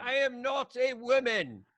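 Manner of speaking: high, slow, hammed-up declaration; mock yell. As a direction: drag it out like a proclamation. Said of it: "yell out that I'm".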